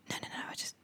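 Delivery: hushed no no no; whispering that you only want the remote; whispered denial. Pretend. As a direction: whisper it, insisting nothing's wrong. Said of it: "voices that are like a whisper".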